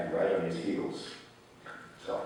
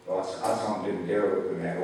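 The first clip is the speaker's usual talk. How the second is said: ordinary delivery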